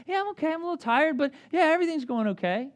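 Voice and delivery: pretending to friends all is fine; fake okay. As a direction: brush off the question with forced cheer.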